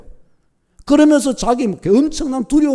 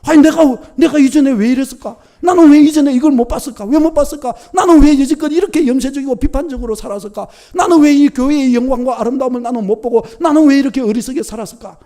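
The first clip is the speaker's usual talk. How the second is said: higher, louder, pleading self-reproach; repentant lament; 7 semitones higher, 4 dB louder than usual. Retold or imitated. New convert lamenting past blindness, loud repentant outcry. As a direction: pour out a stirred-up confession. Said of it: "막 그렇게 막 회개를 막 하면서".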